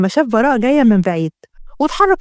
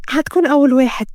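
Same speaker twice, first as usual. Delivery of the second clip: lightly raised feminine voice; young woman's voice. Acted. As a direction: play the girl seeking his promise. Heroine's line, echoed by the man. (صوت فتاة، أعلى قليلًا)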